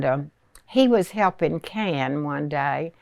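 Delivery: ordinary talk, nothing marked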